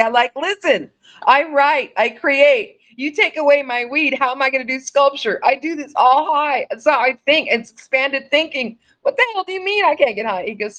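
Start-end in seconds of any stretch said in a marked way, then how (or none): 0.4-6.7 high, loud indignant rant; pissy. Retold or imitated. Artist cussing out the doctor over lost weed, pissy. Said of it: "I was so pissy". 9.0-10.5 very high, fast outraged protest; pissy. Retold or imitated. Patient arguing with the doctor on the phone, pissy. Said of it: "I was so pissy".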